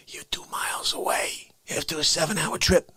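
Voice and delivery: hushed whisper. Acted.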